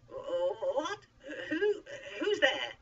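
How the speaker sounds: high, loud, stammering alarm; startled stammer. A narrator's reading. Moses startled by a voice from nowhere, stammering. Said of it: "stammered Moses".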